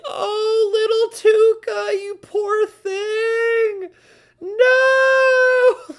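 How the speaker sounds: high, wailing mock cry ending in a shout; mock sobbing. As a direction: overdo the heartbroken whimper, then cry out. Gushing over sad character's story.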